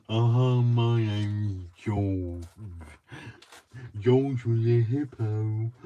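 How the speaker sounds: slow, drawn-out, loud George voice; George the hippo. Retold or imitated. Crafter doing George for her live viewers, slow and loud. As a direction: stretch every word out like a dopey hippo. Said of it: "I can do George".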